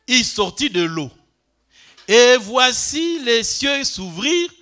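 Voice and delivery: loud, slow, declaimed reading of the verse; solemn scripture reading. Acted. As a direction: proclaim the verse to the whole church. Reads the verses aloud to congregation. (lecture solennelle et forte)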